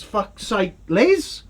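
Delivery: normal talking voice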